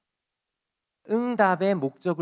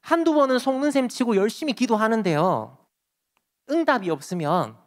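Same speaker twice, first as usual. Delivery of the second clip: normal talking voice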